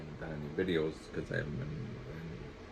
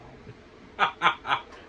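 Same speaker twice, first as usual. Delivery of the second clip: breathy laugh; 11 semitones higher, 8 dB louder than usual. Real, not acted. Laughs at own mix-up.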